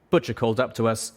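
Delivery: usual speaking voice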